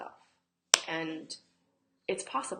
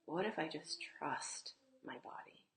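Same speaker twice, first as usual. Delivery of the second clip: whisper; 3 semitones lower, 6 dB quieter than usual. Shown as a demonstration. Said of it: "whispered calming question".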